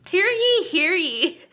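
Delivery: loud proclamation, hear ye; town crier. Pretend. Announcing like a crier.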